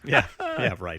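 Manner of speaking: dry, low, deadpan sarcasm; sarcastic. Real, not acted. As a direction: say it like you don't believe a word.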